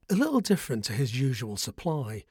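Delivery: usual speaking voice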